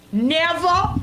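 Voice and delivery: ordinary delivery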